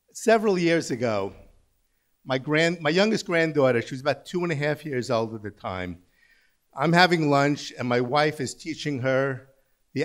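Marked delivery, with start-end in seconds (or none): none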